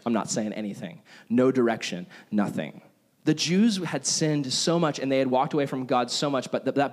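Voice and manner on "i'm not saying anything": a lower, softer voice for God, a bit flat; lightly voiced God, keeping quiet and deadpan; God answering from far above, low and quiet; God's reply. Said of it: "And he's just like".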